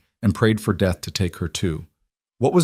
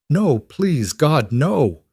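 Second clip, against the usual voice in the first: anguished plea; 6 semitones higher, much slower than usual. A narrator's reading. Mother pleading as child taken.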